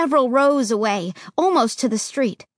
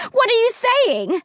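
crying out; 11 semitones higher than usual. Acted. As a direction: cry out in fear. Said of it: "I cried".

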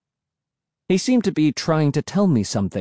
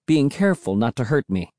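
usual speaking voice

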